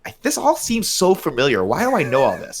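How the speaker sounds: high, puzzled mock Mendes voice; a lightly voiced confused director; imitating Sam Mendes. Podcast host mocks the director, puzzled. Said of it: "Sam Mendes was like".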